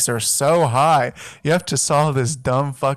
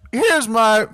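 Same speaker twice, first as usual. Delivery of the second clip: voice crack; 9 semitones higher, 4 dB louder than usual. Real, not acted. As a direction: voice cracks up high. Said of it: "that was a sexy voice crack".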